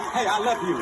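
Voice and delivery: normal talking voice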